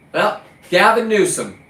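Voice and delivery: loud call at the governor, pitched high; calling out Newsom. Real, not acted. Fisherman calling out Gavin Newsom, loud and high. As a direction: shout his name like a challenge.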